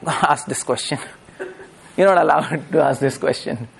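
retold answer broken up by chuckles; laughing retelling. Speaker retells the prize winner's answer to the boy, laughing. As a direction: laugh through the quoted reply. Laughs through quoted reply.

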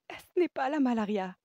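high, loud, elated insistence; excited repetition. A narrator's reading. Woman repeating her discovery to the patient, high and elated. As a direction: exclaim it as wonderful news. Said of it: "répéta-t-elle, l'œil curieusement brillant".

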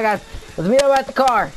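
ordinary delivery

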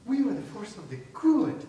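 huffy, indignant caricature of an American; offended American. Retold or imitated. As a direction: play the scandalised patriot, clutching pearls. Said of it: "And they're like".

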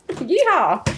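high, laughing cowboy holler; cowboy yeehaw. Pretend. Host laughs and hollers a cowboy yeehaw at cohost. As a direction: whoop it like a rodeo rider. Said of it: "It's the twang".

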